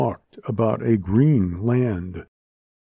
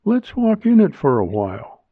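raised-pitch female character; higher voice making a suggestion; woman character's voice; 6 semitones higher, 3 dB louder than usual. A narrator's reading. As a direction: pitch her above the narration.

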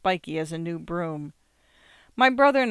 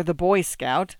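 ordinary delivery

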